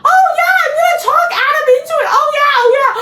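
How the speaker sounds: very high mock eagerness; mocking Jeremiah. Vlogger imitating Jeremiah planning to persuade Adam, high mocking voice. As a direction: parody his cocky plan in a squeaky voice.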